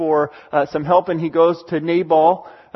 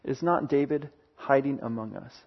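the question dropped much softer and lower, like a secret; hushed repeat; 3 semitones lower, 10 dB quieter than usual. Retold or imitated. The Ziphites slyly telling Saul where David hides, hushed. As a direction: murmur it a second time, conspiratorial. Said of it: "Ziphites went and told Saul".

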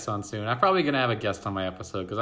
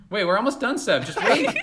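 higher, louder, laughing; laughing plea; 8 semitones higher, 5 dB louder than usual. Real, not acted. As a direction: laugh while begging to wait. Laughing, telling Seb to wait.

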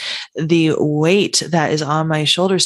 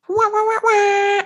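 much higher, silly sing-song wah wah wah; goofy wah wah. Pretend. Host clowning for her podcast listeners with high wah wah. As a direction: toss off a cartoonish high wah sound. Host mimics a sound effect.

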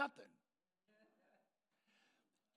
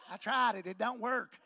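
louder, comic blurted confession; joking loud quote; 2 semitones higher, 11 dB louder than usual. Retold or imitated. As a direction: call it out for the laugh. Joking quote of Ted's reply.

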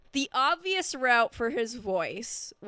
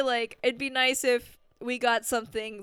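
normal talking voice

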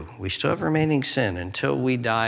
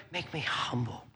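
very soft plea to God; hushed humble request; humble prayer; 2 semitones lower, 12 dB quieter than usual. Acted. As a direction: drop right down into a private prayer. Prayer after confessing arrogance.

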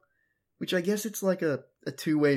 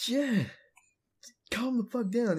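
normal talking voice

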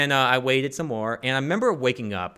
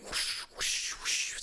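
airy, voiceless whooshes of shredding paper; whooshing sound effects; 10 dB quieter, much slower than usual. Retold or imitated. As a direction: imitate paper being ripped open with whooshes. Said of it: "the sound effects and all".

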